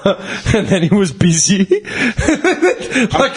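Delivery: breaking into laughter mid-line; giggling through the punchline; laughing. Real, not acted. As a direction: laugh it out as you finish. Laughs at own story.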